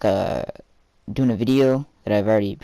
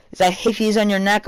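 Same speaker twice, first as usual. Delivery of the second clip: mom voice; 9 semitones higher than usual. Retold or imitated. Mom questioning.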